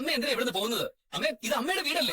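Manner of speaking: high-pitched, agitated appeal; distressed protest. Acted. Son protests as mother threatens to leave home, distressed protest. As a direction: plead with rising alarm.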